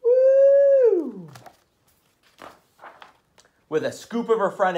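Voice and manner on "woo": excited woo. Acted. Excited reaction.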